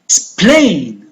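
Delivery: Explain, ex-PLAIN, careful teaching demo; stressed second syllable. Shown as a demonstration. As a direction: Explain with PLAIN long and falling. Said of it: "going to pronounce louder and longer".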